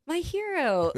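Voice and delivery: mock swoon. Thankful for friend fixing drinks.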